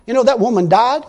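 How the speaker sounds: usual speaking voice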